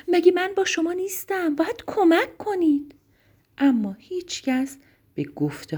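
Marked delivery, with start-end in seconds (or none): 0.1-2.9 high-pitched insistent plea; urgent storybook rabbit voice; urgent pleading. A narrator's reading. Rabbit pleading with quarrelling animals.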